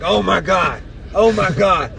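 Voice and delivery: high, loud startled panic; panicked. Retold or imitated. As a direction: jolt awake in a panic. Retold panic waking at wheel.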